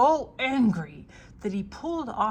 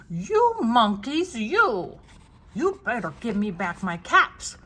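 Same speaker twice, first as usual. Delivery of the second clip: angry peddler; 2 semitones higher, 9 dB louder than usual. A narrator's reading. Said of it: "This made the peddler angry".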